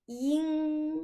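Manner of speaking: yin held high and level; first tone yin. Shown as a demonstration. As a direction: yin on one flat high pitch. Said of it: "Attention la prononciation".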